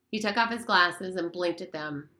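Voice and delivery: usual speaking voice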